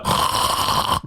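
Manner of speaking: long breathy faint gasp. Acted. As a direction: gasp as you pass out. Acting a character passing out.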